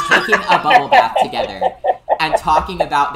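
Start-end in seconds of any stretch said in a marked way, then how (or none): 0.1-1.6 laughing. Real, not acted. Laughing while describing a scene.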